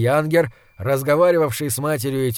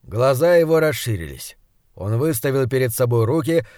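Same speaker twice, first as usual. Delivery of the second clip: usual speaking voice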